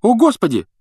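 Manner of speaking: high, loud exasperated cry; exclaiming. A narrator's reading. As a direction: burst in over the teacher. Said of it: "воскликнула Гермиона". (раздражённое восклицание)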